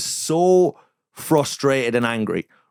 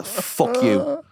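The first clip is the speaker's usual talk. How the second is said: laughing fuck you; 13 semitones higher than usual. Retold or imitated. Laughing replay of insult.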